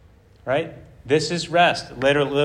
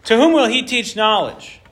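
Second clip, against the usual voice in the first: haughty; 6 semitones higher, 3 dB louder than usual. Acted. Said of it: "some haughty, haughtiness here".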